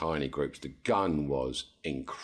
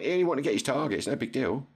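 dismissive dad; 9 semitones higher, 3 dB louder, faster than usual. Retold or imitated. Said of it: "He went".

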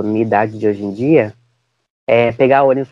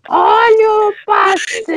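very high, slow drawn-out vendor shout; street vendor cry; 3 dB louder, much slower than usual. Retold or imitated. Pastry seller calling to the whole street. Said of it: "Igual a mulher do pastel".

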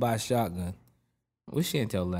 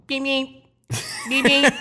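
high-pitched squeaky little horn; funny car beep; 8 dB louder, slower than usual. Retold or imitated. Mocking cameraman's soccer mom car, funny high beep. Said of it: "a little funny beep sound".

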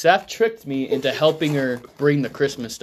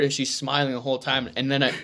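ordinary delivery

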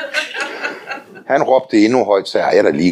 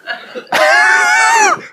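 burst of laughter. Real, not acted. Preacher laughing at his own tale of the women. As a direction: laugh out hard.

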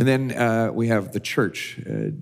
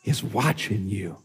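a hint of a hushed, spooky voice from nowhere; eerie hidden voice; much slower than usual. Preacher voicing a parrot to a burglar, mild spooky hush. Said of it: "Then he hears it again".

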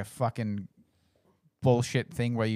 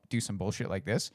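normal talking voice

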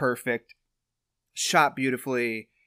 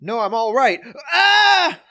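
high strained cry ending in a loud death scream; high, loud crash scream of a dying pilot; doomed pilot; 12 semitones higher, 7 dB louder, much slower than usual. Retold or imitated. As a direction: act out a pilot crashing, yelling. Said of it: "why does this sound so familiar".

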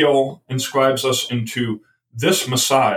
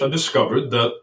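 ordinary talk, nothing marked

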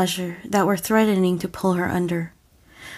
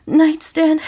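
high, loud, breathless gasp; 8 semitones higher, 5 dB louder than usual. Acted. Ray answering Ben out of breath, high gasp. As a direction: gasp it out, barely able to breathe. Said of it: "Ray gasped out".

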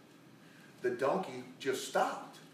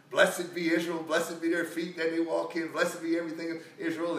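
higher, louder chant-like proclamation; proclaimed blessing; 4 semitones higher, 5 dB louder than usual. Retold or imitated. Prophet on the mountain blessing Israel, proclaimed out loud. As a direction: declare a retold blessing boldly.

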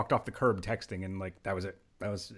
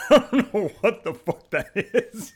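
higher and louder, laughing through the words; laughing disbelief; 9 semitones higher, 6 dB louder, slower than usual. Real, not acted. Host mocking his guest's bad accent, laughing out loud. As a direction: crack up at a terrible accent. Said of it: "That is not a good New York accent".

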